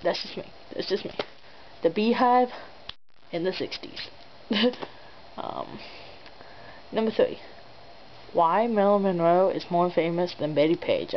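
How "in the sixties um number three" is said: usual speaking voice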